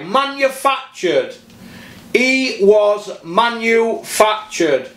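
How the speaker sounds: manufactured spelled out slowly, falling; slow emphasis. Real, not acted. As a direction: drag out manufactured with scorn. Hammering one word in a rant.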